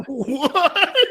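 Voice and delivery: high, laughing disbelief; astonished. Real, not acted. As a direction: blurt it high through a laugh. Listener reacts to acid story.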